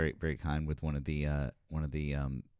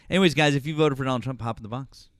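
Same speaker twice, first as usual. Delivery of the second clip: fast, loud, pitched-up shout to the chat; excited call-out; 7 semitones higher, 8 dB louder, faster than usual. Real, not acted. Host hyping up live viewers, fast loud call-out. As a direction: rattle it off loudly to grab the viewers.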